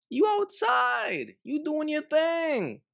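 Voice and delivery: high, drawn-out teasing call; high-pitched call-out. Acted. Commentator calling out the woman for being outside, high-pitched call-out. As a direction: pitch it way up and stretch the words.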